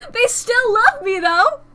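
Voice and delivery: high, loud burst of delight; excited exclamation. Real, not acted. Streamer reacting to still being loved, excited high cry.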